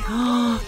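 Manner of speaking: high, quick gasp. Real, not acted. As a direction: suck in a startled breath. Reacting to the Sim's grade.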